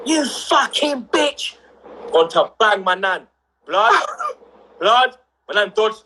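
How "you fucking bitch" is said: slow, drawn-out mock scream, higher; wife's screamed insult. The wife jumping out of the car at nan, furious yell.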